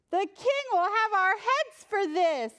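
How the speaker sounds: high, strained worried voice; the king's men fretting, high and anxious; grim king's men. Acted. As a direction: dread the king's anger. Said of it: "the men said grimly".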